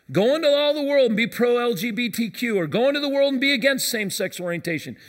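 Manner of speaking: loud, driving sermon voice; full-force preaching. Acted. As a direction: preach it out with rising conviction.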